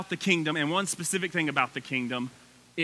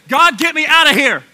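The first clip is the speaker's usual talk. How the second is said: mock plea; 8 semitones higher, 14 dB louder than usual. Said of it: "He didn't say".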